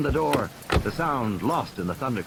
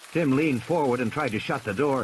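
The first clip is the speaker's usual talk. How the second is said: usual speaking voice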